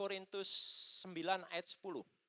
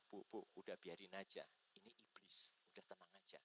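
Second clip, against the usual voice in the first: soft, low reassurance; calming aside; 3 semitones lower, 9 dB quieter than usual. Retold or imitated. Pastor tells the mother to let the demon be, calming softly. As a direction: settle her down quietly. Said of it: "saya bilang".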